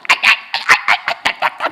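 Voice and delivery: slow breathy mock snore; mock snoring. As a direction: snore it out in fun, unvoiced. Preacher mimics his own snoring.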